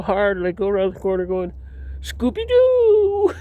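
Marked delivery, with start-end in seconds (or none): 2.0-3.3 long, very high drawn-out call; Scooby-Doo call. Retold or imitated. Calling out to the laughing kids, long high playful howl. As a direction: stretch it out like a cartoon howl.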